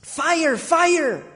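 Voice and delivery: high, mock child's cry of fire; kid screaming. A kid in the hallway shouting a false alarm, high mock scream. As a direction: pitch it up like a panicked little kid. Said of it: "this kid starts screaming".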